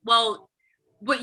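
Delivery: ordinary talk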